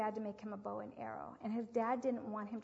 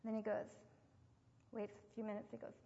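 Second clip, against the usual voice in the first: normal talking voice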